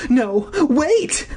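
high desperate call; crying out. A narrator's reading. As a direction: shout to stop her. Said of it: "I cried".

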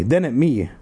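plain reading voice

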